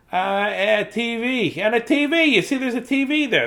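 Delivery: cheerful storybook mouse showing off the house. Acted. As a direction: chirp it out like a little mouse. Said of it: "this is the mouse voice there".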